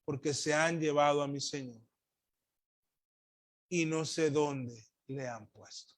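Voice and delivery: ordinary talk, nothing marked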